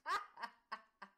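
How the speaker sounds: short loud bark of a laugh. Acted. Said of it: "Svava barks out a laugh".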